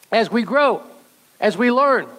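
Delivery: usual speaking voice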